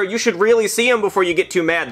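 normal talking voice

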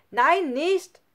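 high, loud cry to stop; alarmed shout. Acted. Lukas trying to stop Nele shooting, shouting loudly. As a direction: yell to stop her in alarm. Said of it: "rief Lukas".

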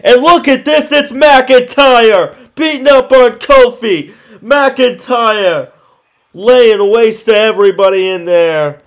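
loud, high hyped-up call; wrestling commentator. Acted. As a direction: shout the action like ringside. Live wrestling play-by-play.

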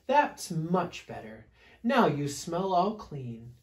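slow, lightly lilting character voice; fluffy bear's approval. Acted. Fluffy bear praising the washed bear, slow lilting approval. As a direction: approve slowly, stretching the praise. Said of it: "she said".

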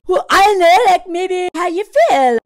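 loud put-on Frank voice. Husband demanding his wife say how she feels, loud.

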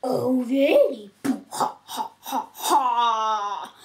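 mock evil chuckle; villain laugh. Bowser mocking laugh.

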